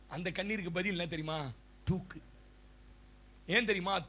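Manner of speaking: ordinary talk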